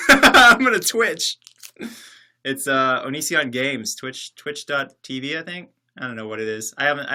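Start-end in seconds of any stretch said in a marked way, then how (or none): 0.0-1.4 laughing through a silly pun. Real, not acted. Streamer cracking up at own pun, laughing. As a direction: giggle the words out slowly.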